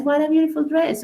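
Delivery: "What a beautiful dress" quick and high-pitched; quick, lifted emphasis on "What a beautiful dress"; emphatic intonation. Shown as a demonstration. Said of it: "you increase or decrease the intonation of the words".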